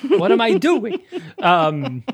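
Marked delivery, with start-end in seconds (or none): 0.1-1.0 higher-pitched mock exasperation; confused listeners. Host voicing listeners dropped mid-story, mock confused. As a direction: play a lost listener, pitch jumping at the final question. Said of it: "that's how you all sound to us".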